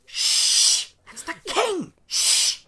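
high, urgent hush in character; acted shushing with a squeaky middle; hushing onlooker. Awed onlooker hushes the talker.

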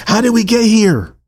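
high-pitched cry of disbelief; impassioned question. Real, not acted. Preacher naming the episode, impassioned high cry. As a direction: send the question up high, then fall.

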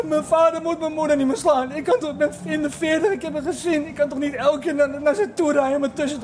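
high, distant raving; delirious rambling. Retold or imitated. Delirious friend raves about his parents, high rambling. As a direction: ramble like a man in a fever. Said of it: "die begon te ijlen".

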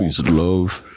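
[name]. Acted. Player echoing the host, [name] impression.

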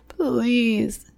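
high, drawn-out tearful begging; sobbed plea. A narrator's reading. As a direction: sob the word out helplessly. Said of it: "he sobbed".

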